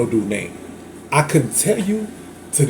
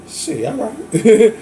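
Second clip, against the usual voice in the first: drawn-out shrug; 7 semitones higher, much slower than usual. Retold or imitated. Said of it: "I'm like".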